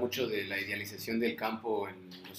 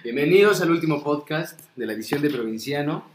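loud, raised-pitch podcast welcome; big, high, loud show opening; speaking up louder; 5 semitones higher, 11 dB louder than usual. Real, not acted. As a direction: project it as if told to speak up. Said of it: "Habla más fuerte".

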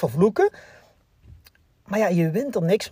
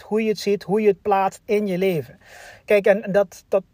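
ordinary talk, nothing marked

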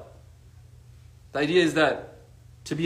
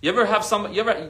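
ordinary talk

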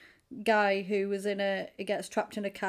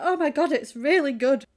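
loud, higher, hammed-up delight; gushing praise; 4 semitones higher, 7 dB louder than usual. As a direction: gush like a thrilled fan. Acting out a gushing reader.

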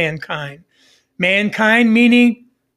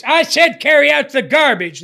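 higher, louder parent's order; exasperated parent, raised higher and louder; really raised voice; 5 semitones higher, 4 dB louder than usual. Retold or imitated. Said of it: "So you really raise your voice".

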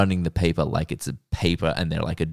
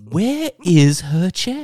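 mock shock; 10 semitones higher, 5 dB louder, much slower than usual. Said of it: "I was like".

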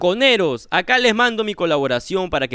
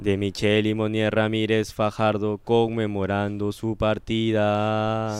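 low, softer, slow funeral tone; mock solemn eulogy; 10 semitones lower, 6 dB quieter than usual. Cohost names the late donor at the joke mass, low and solemn. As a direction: mourn with a straight face.

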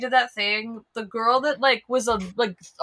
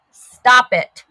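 loud, sudden telling-off; real loud reprimand to a pet; sharp scolding; 2 semitones higher, 11 dB louder than usual. Real, not acted. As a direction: call out sternly to a misbehaving cat. Scolding her cat mid-sentence.

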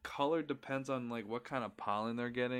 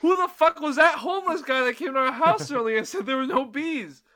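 much higher, louder outraged quote; indignant yell; 12 semitones higher, 12 dB louder than usual. Retold or imitated. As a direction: bellow it in disbelief at the first guy. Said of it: "my next one goes".

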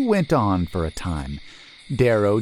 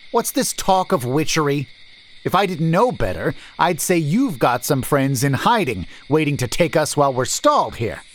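higher, quick wary merchant voice; suspicious merchant; 7 semitones higher, 3 dB louder than usual. A narrator's reading. Merchant accusing the stranger of an ambush, wary. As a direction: probe him for a trap.

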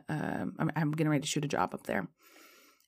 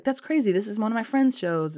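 mock amazed; 6 semitones higher, 4 dB louder than usual. Guy reacts to coincidence.

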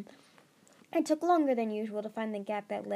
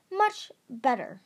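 slow, louder and higher, a touch harsh; slow stern voice, higher and louder; stern dragon voice; 4 semitones higher, 7 dB louder, slower than usual. A narrator's reading. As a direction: clip it out like a bossy dragon. Kestrel's scolding line in story.

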